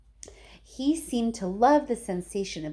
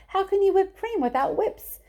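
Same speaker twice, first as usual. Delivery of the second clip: indignant Wonka; 12 semitones higher, 5 dB louder than usual. A narrator's reading.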